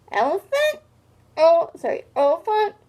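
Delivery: can't believe what he heard; incredulous. Acted. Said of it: "wondered Rudy".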